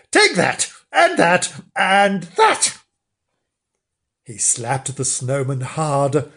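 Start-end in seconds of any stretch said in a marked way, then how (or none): 0.1-2.8 high, punchy bursts of temper; storybook fury in short high bursts; enraged shout. Acted. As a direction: shout with each blow. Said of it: "shouted Mister Flange in a rage".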